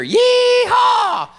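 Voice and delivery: very high, loud yee-haw; cowboy whoop. Acted. Cowboys chasing you down, high whooping shout. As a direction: holler it like a rodeo cowboy.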